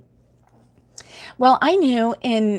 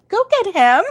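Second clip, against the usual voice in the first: high, louder urgent plea; urgent call; 10 semitones higher, 5 dB louder than usual. Retold or imitated. Grandmother telling her husband to chase the grandson, urgent. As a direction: send him after the boy. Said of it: "I'm like".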